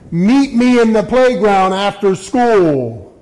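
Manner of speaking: mock tough-kid challenge; schoolyard challenge. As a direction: call him out like a school bully. Bully's schoolyard challenge quoted.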